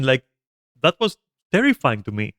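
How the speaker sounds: usual speaking voice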